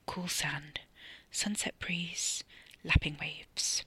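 soft, slow near-whisper; self-soothing. A narrator's reading. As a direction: breathe each image out to calm yourself. Character silently calms himself with imagery.

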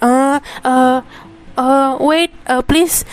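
lightly imitated flustered stammering; mock panic. Admin staff flustered by an English question, mock panic. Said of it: "dia panik dia kayak".

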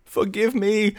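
high, pleading plea; begging. A narrator's reading. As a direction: beg for mercy. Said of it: "begged Mary".

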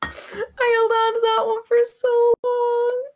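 slow, high, wheezing through laughter; barely getting words out between laughs; after laughing hard. Real, not acted. Still laughing at own joke.

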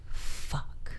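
breathy whispered fuck; whispered curse. Retold or imitated. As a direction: whisper it in dread. Retold panic in hotel room.

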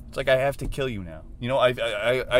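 usual speaking voice

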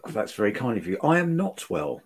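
usual speaking voice

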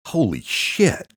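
slow, high, loud exclamation; stunned. A narrator's reading. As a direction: draw it out in shocked disbelief. Stunned by photos on his phone.

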